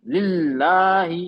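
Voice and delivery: slow लिल्लाही with the double ल held hard; slow emphatic recitation of लिल्लाही; doubled l stressed. Shown as a demonstration. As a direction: press firmly on the ल्ल of लिल्लाही. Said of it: "emphasise कर रहा हूँ, stress कर रहा हूँ".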